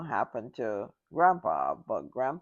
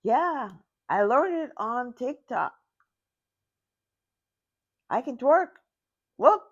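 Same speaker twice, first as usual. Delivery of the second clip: proud grandma; 7 semitones higher, 3 dB louder than usual. Retold or imitated. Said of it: "She was like".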